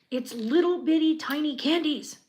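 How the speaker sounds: high, loud fangirl squeal; excited squeal. Real, not acted. Builder squeals to viewers over tiny candies. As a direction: squeal at something tiny and cute.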